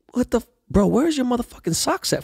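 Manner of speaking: very high-pitched mock outrage; appalled disbelief. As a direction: act out being scandalized. Retold outrage at bare feet.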